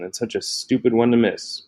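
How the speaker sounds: ordinary delivery, nothing marked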